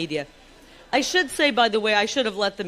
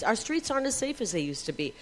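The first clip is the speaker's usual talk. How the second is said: ordinary delivery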